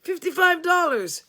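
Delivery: high loud disbelief; incredulous repeat. A narrator's reading. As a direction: repeat it in shock. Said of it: "he repeated".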